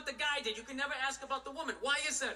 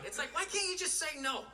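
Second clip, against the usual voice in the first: ordinary delivery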